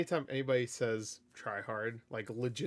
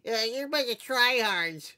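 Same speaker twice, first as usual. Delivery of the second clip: high, drawn-out mock kid; put-on high-pitched kid's taunt; 9 semitones higher, 4 dB louder than usual. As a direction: whine it like a child, slowly. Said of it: "If it was, like, a kid saying it".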